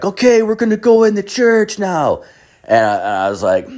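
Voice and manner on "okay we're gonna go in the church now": high, put-on tour guide announcing the plan. Tour guide telling the group to go inside, high put-on voice. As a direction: mimic a chirpy guide leading the group. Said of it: "And the guy's like".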